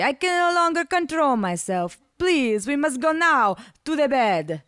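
high, slow, pleading Italian lover; drunken Italian begging; Italian accent. A narrator's reading. Ivan voiced, drunk pleading.

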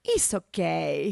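put-on slow, accented reassurance; tender, drawn-out comforting; kindly murmur. A narrator's reading. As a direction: murmur it gently to comfort her. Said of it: "he murmured kindly".

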